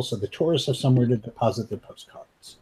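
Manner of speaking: ordinary talk, nothing marked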